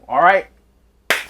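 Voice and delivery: ordinary talk, nothing marked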